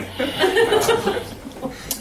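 airy high-pitched laugh burst; a breathless laugh at bad news; high breathy laugh. Real, not acted. Hearing the dad died and wife left, a high airy laugh.

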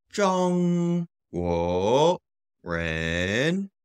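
ZHONG high and level, GUO and REN rising; long high ZHONG, two climbing syllables; tone demonstration. Shown as a demonstration. Teacher demonstrates Chinese people.

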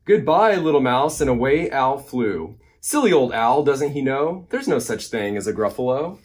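lively, singsong picture-book reading; animated storytime narration; reading to children. A narrator's reading. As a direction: read the rhyme with big bouncy emphasis. Reads a rhyming picture book.

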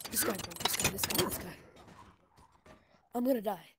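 ordinary talk

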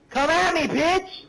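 loud, high taunting yell; shouted taunt. Real, not acted. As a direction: scream the taunt at him. Repeats taunt, louder and higher.

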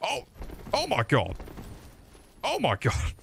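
hammed-up breathless astonishment; mock game-ad guy. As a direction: imitate an overreacting ad with falsetto squeals. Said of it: "while I'm going".

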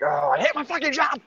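high-pitched mock scream of frustration; imitated scream. Friend retelling a grocery worker's movie outburst, mock scream. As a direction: do an over-the-top fed-up worker scream. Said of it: "it's the scream".

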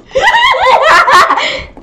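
loud, very high cackle; real squealing laughter; shrieking laugh. Real, not acted. After shouting in game.

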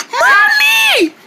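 high loud call for Mommy; frightened kid calling out. Acted. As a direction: yell for help across the house. Children run calling for mother.

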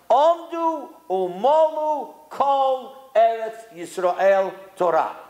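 very slow, drawn-out declamation; solemn quotation. Retold or imitated. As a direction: stretch out each word of the sacred text. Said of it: "listen to what he says".

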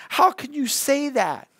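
high-pitched, disbelieving reproach; shocked surprise. A narrator's reading. Aunt answering her nephew's outburst, wide-eyed with surprise. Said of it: "her eyes wide open with surprise".

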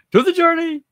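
very high, loud put-on cheer; Charlene Schmidt voice. Host showing off a Charlene Schmidt voice, high and loud. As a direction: belt it out high like an impression. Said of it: "do that in my Char voice, my, my Charlene Schmidt voice".

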